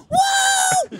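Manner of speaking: imitating Rob's shouted Woo, freaking out; mock cheer. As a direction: whoop it like he just won at roulette. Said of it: "He's freaking out".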